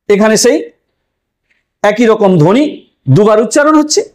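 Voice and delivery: ordinary talk, nothing marked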